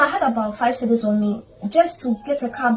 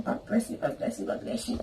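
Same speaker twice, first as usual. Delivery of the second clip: softer and softer until whispered; fading thanks; 2 semitones lower, 14 dB quieter than usual. Retold or imitated. To her supervisor on the phone, a thanks fading into a whisper. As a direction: let each blessing fade into a breath.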